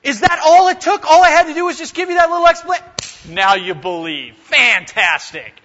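dripping, mock-impressed sarcasm; heavy irony. Jesus mocking the disciples' quick belief, dripping sarcasm. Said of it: "He's thick with irony".

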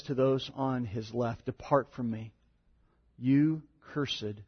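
grave scripture reading. Acted. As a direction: let the judgment sink in. Reads the curse, quieter.

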